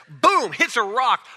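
normal talking voice

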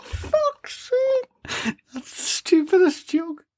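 laughing through the words; cracking up mid-sentence. Real, not acted.

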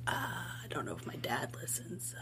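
whispered aside, worried. Retold or imitated. Nervous about her dad listening, whispered. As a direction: hush it like the dad might hear.

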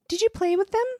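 put-on eager small girl's question; child voice. Granddaughter asks grandmother about the dolls, high eager child voice.

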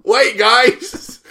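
high urgent call; high mock call; calling after them. Left behind calling out.

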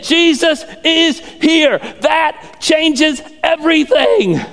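high, slow emphatic proclamation; full-force preaching. Real, not acted. Preacher proclaiming what changes everything, full-force preaching. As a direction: ring out each word slowly.

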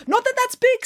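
high hasty reassurance; quick backpedal. Acted. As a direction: rush to smooth it over. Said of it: "said Nanny Piggins".

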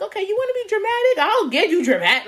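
high, quick retold challenge; hammed-up comeback; dramatic defiance. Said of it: "you're being so dramatic".

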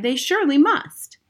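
put-on high, loud boyish cry; desperate boy shouting; cried out. A narrator's reading. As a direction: protest it, a child crying out. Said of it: "I cried".

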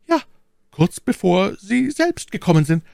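very high, loud maid's voice; aufgeregte Ketty. Acted. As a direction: report it anxiously in a high voice. Anxious maid answers question. (aufgeregte, hohe Mädchenstimme)

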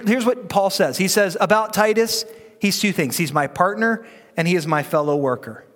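normal talking voice

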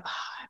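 very quiet breathy release; soft sigh. Shown as a demonstration. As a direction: let the breath go. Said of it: "then we just go".

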